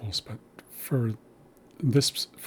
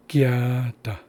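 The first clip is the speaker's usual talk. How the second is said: gya da with gya stressed and da dropped; stress on the first syllable; 4 dB louder than usual. Shown as a demonstration. Teacher showing first-syllable stress, gya leaned on. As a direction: lean on gya, then let da fall. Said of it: "you emphasize the first syllable".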